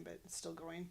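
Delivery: ordinary talk, nothing marked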